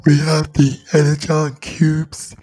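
slow, drawn-out monster voice; demonic movie villain. Acted. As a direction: draw it out like a hungry demon. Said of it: "That's what it's like".

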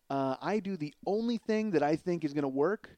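ordinary talk

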